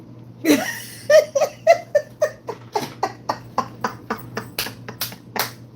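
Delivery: long high laugh that turns to crying; laughing through tears. Real, not acted. Moved to tears in front of viewers, laughing while crying. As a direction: laugh and sob together.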